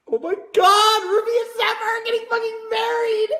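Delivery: high and excited, close to a squeal; overjoyed fan. Real, not acted. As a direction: gush about the wedding like a thrilled fan. Fan excited over the wedding news.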